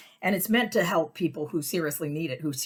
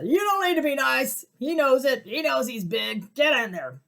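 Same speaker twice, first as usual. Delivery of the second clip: much higher, louder rude order; mock drunk bark; 8 semitones higher, 5 dB louder than usual. Drunk father yelling at his son to sit in back. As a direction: bark it at the kid like a boorish drunk.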